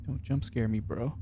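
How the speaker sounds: nervous, hushed under the breath; uneasy quiet muttering in the dark; scared horror game streamer. Real, not acted. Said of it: "am I gonna get scared in this game?".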